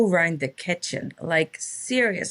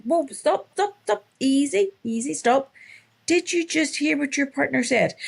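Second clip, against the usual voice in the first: much higher, repeated halting calls; urgent interruption; 8 semitones higher than usual. Retold or imitated. Coach stopping a woman who keeps going, urgent and insistent. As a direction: rein in someone who won't stop talking.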